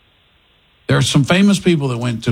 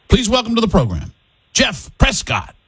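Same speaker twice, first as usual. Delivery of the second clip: announcer intro; 7 semitones higher than usual. Acted. Host calls the caller in like a stage announcer. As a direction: give him the big announcer welcome. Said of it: "Okay, here we go".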